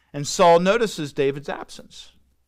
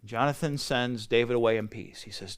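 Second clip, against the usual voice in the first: usual speaking voice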